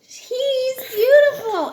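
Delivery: high, slow and breathless; admiring gasp. Acted. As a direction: gush over the pony. Annie admiring the pony.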